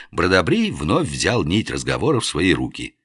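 plain reading voice

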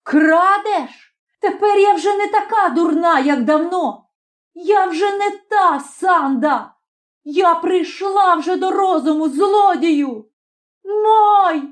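high-pitched furious outburst; girl crying out in rage; unprecedented anger. A narrator's reading. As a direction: hurl defiant accusations, voice high. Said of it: "з нечуваним гнівом".

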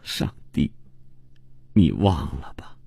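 breath-only whispered moan; delirious, whimpering whisper to God; feeble moaning. A narrator's reading. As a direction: murmur it weakly, almost voiceless, with gaps. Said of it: "哼唧着说".

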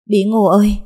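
a high, loud, longing call across the room; mother calling out to her daughter, loud and high; calling out to her child. Acted. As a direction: call her little girl's name with relief.

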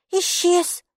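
put-on very high child voice, one word; little girl's voice. A narrator's reading. Maiki says the creature has vanished, high child voice. Small girl reports creature gone.

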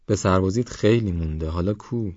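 ordinary delivery, nothing marked

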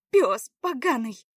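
loud, drawn-out irritated insult. A narrator's reading. Заряна to an unwelcome man, irritated. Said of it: "произнесла раздражённо Заряна".

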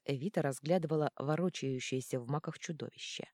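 plain reading voice

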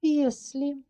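put-on high, teasing girl's voice, rising; mysterious. A narrator's reading. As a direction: say it mysteriously, with a tease. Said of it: "загадочно произнесла".

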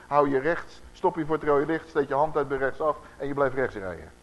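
normal talking voice

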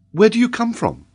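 high lady's question; kindly lady. A narrator's reading. Lady Helena questions the child.

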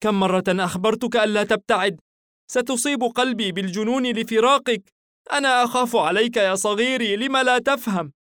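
high voice, crying mother; a frightened mother scolding through tears; worried mother, high voice. A narrator's reading. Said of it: "نارا تصرخ وتبكي".

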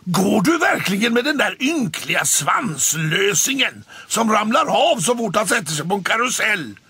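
loud, high scornful jeer; mock the tailless cat; loud contemptuous taunt; jealous sneer. A narrator's reading. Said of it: "sa Måns".

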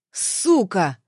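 hard, spat-out insult in an altered voice; angry curse. A narrator's reading. Man about to strike the woman, cursing angrily. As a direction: spit the word out in anger. Man swears, swings at her.

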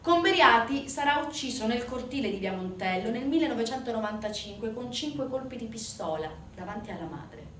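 normal talking voice

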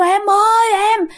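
high-pitched mock cry for help; frightened calling. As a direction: call out to the wife in imitated panic. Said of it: "ông đập cửa kêu". (giả giọng hoảng sợ gọi vợ)

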